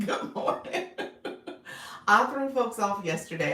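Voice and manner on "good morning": good morning through a giggle; laughing greeting. Real, not acted. Laughs while greeting viewers.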